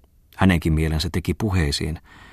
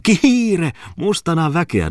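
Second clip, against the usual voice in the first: laughing; 12 semitones higher, 4 dB louder, slower than usual. Acted. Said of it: "nauroi Muttinen".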